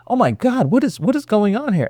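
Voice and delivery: high, hushed shock; alarmed bystanders. Acted. Onlookers stopping at a yelling customer, hushed alarm. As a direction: react in startled disbelief under your breath. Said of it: "everybody stop what they're doing".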